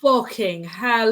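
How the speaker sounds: ordinary talk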